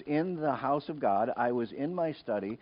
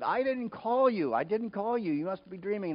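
acting out a put-upon elder; exasperated denial; 9 semitones higher than usual. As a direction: deny it to a pestering child. Eli denies calling, woken again.

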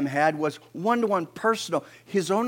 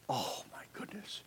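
hushed, whispered amazement; awed whisper; 13 dB quieter than usual. Retold or imitated. Preacher reliving sight of stars, awed whisper. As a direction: breathe it out in a whisper. Retells awe at starry sky.

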